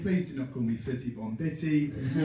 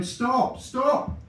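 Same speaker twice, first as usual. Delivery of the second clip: higher, louder hammed-up heroic cry; heroic shout; 8 semitones higher, 4 dB louder, slower than usual. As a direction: burst in shouting to stop the nurse. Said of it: "He's shouting".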